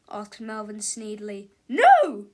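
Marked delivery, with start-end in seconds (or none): 1.7-2.3 very loud, high outburst; explosive teacher's refusal; blunt refusal. A narrator's reading. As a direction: refuse with a shout. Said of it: "said Miss Ribble".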